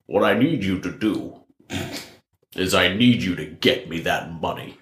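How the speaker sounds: low, measured Kingpin menace; very stern. Acted. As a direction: crime boss talking very sternly. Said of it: "talking to you very sternly".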